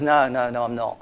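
low, quiet frightened denial; a touch of fear, voice dropping away; afraid denial. Retold or imitated. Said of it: "so afraid".

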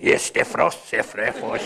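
mock Russian-accented praying in tongues. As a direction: pray in a put-on Russian accent for laughs. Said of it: "I do it with a Russian accent".

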